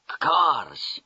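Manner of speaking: a delighted Russian cry of the word Cars!; Russian-accented exclamation. Acted. The Russian major, seeing shiny new cars, exclaims in a Russian accent. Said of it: "he exclaimed".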